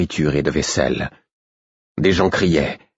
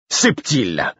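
furious; 3 semitones higher than usual. A narrator's reading. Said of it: "répondis-je furieux".

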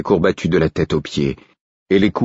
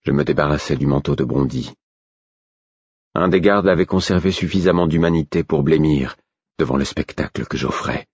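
ordinary delivery

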